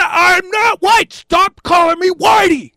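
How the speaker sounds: high, slow, furious shout; looking-to-kill anger. Acted. Fed-up man warning off his heckler, furious shout. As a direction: stretch every word into an outraged yell. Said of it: "I'm looking like I'm gonna kill".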